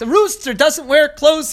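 high, slow, shocked protest; the prince can't believe his eyes. Acted. Prince objecting to the wise man dressing, shocked and high. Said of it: "The prince was shocked".

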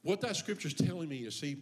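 ordinary delivery

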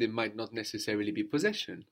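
ordinary talk

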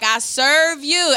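slow pleading prayer. Retold or imitated. Mother bargaining with the Lord, slow pleading. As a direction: beg it slowly and loud. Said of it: "I'm like".

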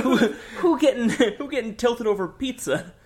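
laughing. Real, not acted. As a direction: giggle it out, amused. Laughing at pizza scene.

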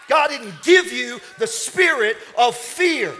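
loud, high, punchy sermon shout; full-force preaching. Real, not acted. Preacher telling the congregation fear is only a spirit, shouted with fervor. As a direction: drive each phrase out like a fired-up preacher.

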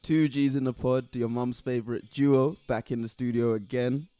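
hype show intro. Acted. Hosts open the show.

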